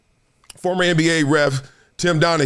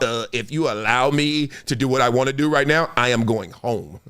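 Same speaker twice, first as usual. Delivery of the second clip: low, slowing mock-polite plea; SpongeBob quote; 7 semitones lower than usual. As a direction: lower and dragging at the end. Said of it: "what they say on SpongeBob".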